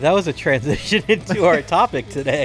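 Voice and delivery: laughing through the words; chuckling while saying it. Real, not acted. Cohost laughs mid-line.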